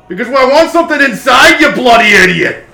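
high, loud, exasperated character yell; coffin's angry shout. Acted. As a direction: yell at an intruder as a cranky construct. Coffin snaps at the adventurers.

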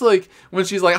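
ordinary talk

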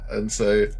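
normal talking voice

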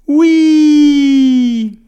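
high, loud, drawn-out "whee"; long gleeful whee. Acted. Sledders racing down the mountain, long high whee. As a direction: squeal down the slope in one long note.

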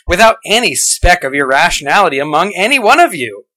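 mock-amazed sarcasm, questions lilting upward; sarcastic. Podcaster mocking atheists who claim open minds, sarcastic. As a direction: feign wonder at how perfect they are. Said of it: "Sorry for the sarcasm".

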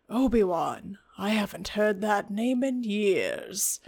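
lightly voiced wise hermit remembering; wistful old mentor. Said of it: "goes like".

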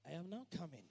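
quiet refrain. Acted. Preacher quieting after the climax. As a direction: drop it to a near hush.

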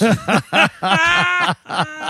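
high, loud burst of laughter; big helpless laugh; real laugh. Real, not acted. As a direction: crack up at your own story. Laughs at the many emails.

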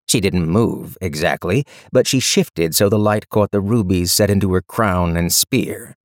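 ordinary delivery, nothing marked

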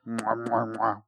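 smack big kisses into the mic; big wet smooch. Pretend. Blowing kisses to Tom Nye.

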